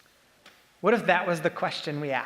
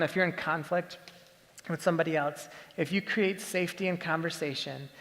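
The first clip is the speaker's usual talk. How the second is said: ordinary talk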